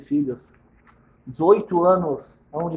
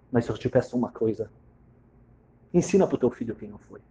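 low, soft, almost spent; soft last request; 7 semitones lower, 7 dB quieter than usual. Acted. Dying wife quietly asks husband to teach their son. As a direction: murmur it like a final wish.

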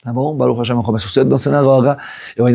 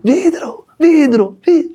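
put-on high, frantic child's cry; much slower than usual. Injured son crying about the glass, high and frantic. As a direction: cry out the word like a hurt kid.

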